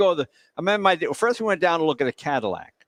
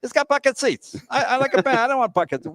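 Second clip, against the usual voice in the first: higher, complaining dad voice; disappointed dad; 8 semitones higher than usual. Retold or imitated. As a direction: grumble like a let-down father. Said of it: "my dad's disappointed".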